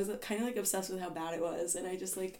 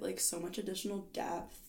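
ordinary delivery

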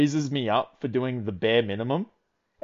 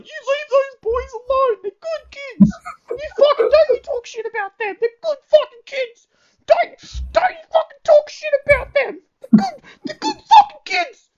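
very high, louder, breaking into fake sobs; mock tearful boss; 4 dB louder than usual. Boss defending Barry and Nathan from the badmouthing supervisor, squeaky fake crying. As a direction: squeal it like a sobbing old man protecting his boys.

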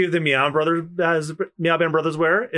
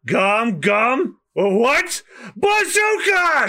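attack shout; 7 semitones higher, much slower than usual. Acted. Luffy attack call.

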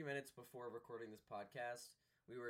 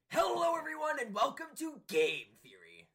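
shouted, much higher host intro; Game Theory intro impression; 16 dB louder than usual. Podcaster doing his best Game Theory intro, shouted high. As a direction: bellow it like a hyped YouTuber opening. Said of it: "I'll do the game theory intro".

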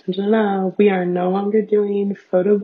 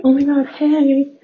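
high, bright surprised hello; excited greeting; 7 semitones higher, 5 dB louder than usual. Retold or imitated. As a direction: gush at a friend you bumped into. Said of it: "and then I'm like".

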